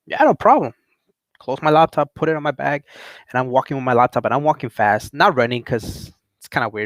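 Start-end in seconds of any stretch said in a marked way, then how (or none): 0.1-0.7 high-pitched, eager yes; high, obliging reply; eager agreement. Retold or imitated. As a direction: agree readily, voice jumping up high. Eager reply to manager's request.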